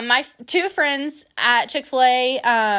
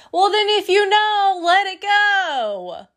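imitating friend; 9 semitones higher, 3 dB louder, slower than usual. Friend Emily lecturing her, voiced.

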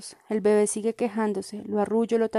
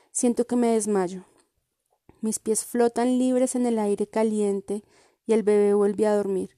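usual speaking voice

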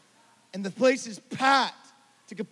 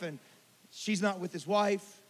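ordinary talk

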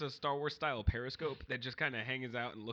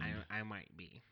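softer, lower timid coworker; lightly voiced meek coworker Larry; meek office guy; 7 semitones lower, 7 dB quieter than usual. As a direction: mumble like a shy office drone.

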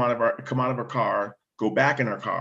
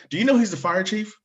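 incredulous lieutenant; 9 semitones higher, faster than usual. Retold or imitated. Lieutenant shocked at the cops.

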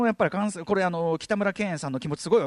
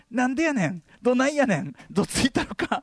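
raised-pitch mock Kansai retorts; worsening fake Kansai accent; 5 semitones higher than usual. Overconfident student throwing retorts in fake Kansai accent. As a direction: pile on clumsy Kansai tsukkomi lines. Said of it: "関西弁イキリはどんどんひどくなり".

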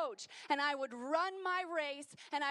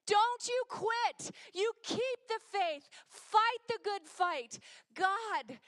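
preaching at full force; 5 semitones higher, 4 dB louder than usual. Acted. Preacher urging the church never to quit, at full force. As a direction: drive each line home to the congregation.

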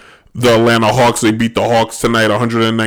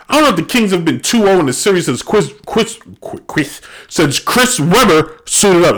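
chewing gum; 6 semitones higher than usual. Real, not acted. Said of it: "I got gum in my mouth".

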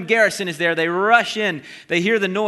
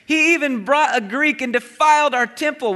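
raised, accusing voice of the crowd; accusing shout; 7 semitones higher, 3 dB louder than usual. Acted. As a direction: point the finger and cry out against him.

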